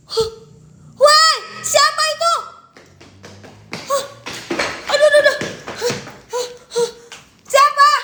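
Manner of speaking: high, startled yells with nervous laugh; frightened shout. Real, not acted. Startled at haunted spot.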